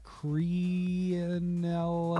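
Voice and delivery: Crenellated sounded out very slowly and broken off; hesitant drawn-out Crenellated; unsure pronunciation. Real, not acted. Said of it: "I don't even know how to say that".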